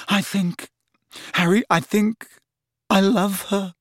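high, choked confession; strangled voice. A narrator's reading. Lovesick lad confessing love, strangled voice. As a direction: squeeze it out haltingly. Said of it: "in a strangled voice".

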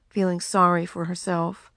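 usual speaking voice

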